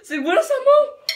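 high, loud outcry; laughing shout. Real, not acted. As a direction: yell to stop the hand. Shouting at the helper hand.

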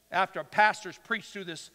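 ordinary delivery

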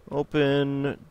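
very slow, spelled-out command voice; typing command. Acted. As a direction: read the command as you type it. Reads typed game commands aloud.

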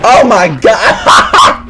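stunned. Real, not acted. Shocked slow exclamation.

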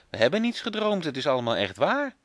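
put-on high, eager child's insistence; excited protest. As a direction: call it out, high and keen. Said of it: "roept Bas".

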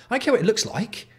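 high, incredulous outburst. Real, not acted. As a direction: scoff at the idea, high. Ranting at jersey copy.

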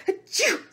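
a high, loud acted sneeze; mock sneeze. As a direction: act out a big silly sneeze. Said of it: "sneezed the monkey".

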